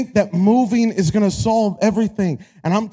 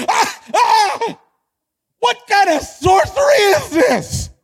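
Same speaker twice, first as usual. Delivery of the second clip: high, shrieked mock disbelief; hammed-up baffled squeal; astonished toddler; 10 semitones higher than usual. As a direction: scream it in comic shock, way up high. Toddler's astonished scream when found.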